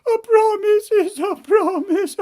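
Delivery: high falsetto whine dissolving into mock sobs; sobbing princess. Princess repeats mother's ruling, mock-sobbing princess voice. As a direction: wail it as a defeated spoiled princess.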